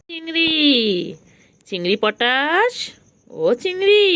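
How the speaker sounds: high, loud drawn-out name call; high affectionate call to the cat; fond calling. Real, not acted. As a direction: sing out the pet's name.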